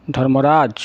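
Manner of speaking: usual speaking voice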